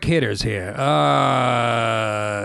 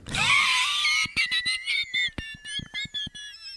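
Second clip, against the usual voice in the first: very high, long squealing laugh; 4 dB quieter than usual. Real, not acted. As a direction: shriek with laughter. Long laugh after joke.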